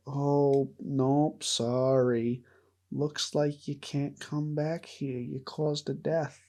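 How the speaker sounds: put-on muddled Canadian accent sliding into Boston; badly faked Canadian deer voice with Boston vowels; accidental Canadian-Boston mash-up. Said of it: "Why did I go Canadian Boston there?".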